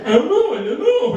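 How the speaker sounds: high, wordless-sounding mumble of incomprehension; pretending to be deaf. Husband feigns deafness when wife asks about movie, muddled and high. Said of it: "just pretending like I'm deaf".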